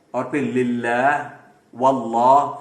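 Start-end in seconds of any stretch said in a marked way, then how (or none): 0.4-1.3 "للہ" with a light, clear L; slow Quran-recitation "للہ", thin lam. Shown as a demonstration. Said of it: "لام کو کہاں کہاں موٹا پڑھنا". 1.7-2.5 "واللہ" with a heavy, dark L; Quran-recitation "واللہ", full thick lam. Shown as a demonstration. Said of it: "لام کو کہاں کہاں موٹا پڑھنا".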